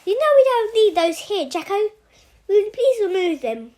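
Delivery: indignant scolding. Acted. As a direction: tell the guard off for the cuffs. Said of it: "full of indignation".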